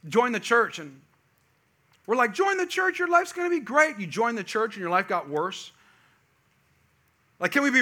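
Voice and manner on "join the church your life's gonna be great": high, chirpy mock invitation; upbeat sales pitch. Church folk inviting newcomers, mock cheerful promise.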